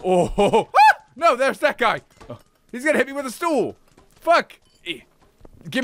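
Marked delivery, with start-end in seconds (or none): 0.8-1.0 high shriek after a laugh; real laughing shriek. Real, not acted. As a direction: squeal with surprise and laughter. Surprised by attacker.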